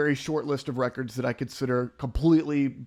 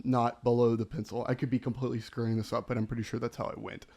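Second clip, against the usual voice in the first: usual speaking voice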